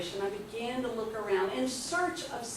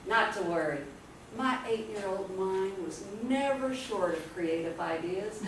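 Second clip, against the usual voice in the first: ordinary talk, nothing marked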